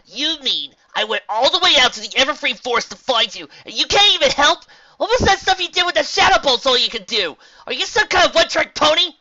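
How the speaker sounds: very high, loud angry pony; screeching outrage. A narrator's reading. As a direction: yell in disbelief, pitch climbing. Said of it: "Rainbow screeched a hole in midair".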